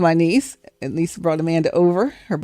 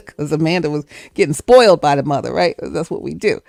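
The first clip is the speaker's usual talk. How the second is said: ordinary talk, nothing marked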